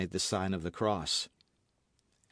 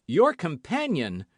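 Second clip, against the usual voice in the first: sharp louder alarmed cry; 5 semitones higher, 5 dB louder than usual. A narrator's reading. D'Artagnan shocked by Constance's news, crying out. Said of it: "cried D'Artagnan".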